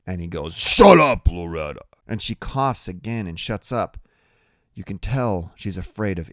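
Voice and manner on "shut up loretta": loud, raised bark, dropping on the name; snapped order. A narrator's reading. Iggy cutting off Loretta's protest, loud snap. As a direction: bark it to silence her. Boss silences interrupting woman.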